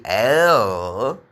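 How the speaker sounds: normal talking voice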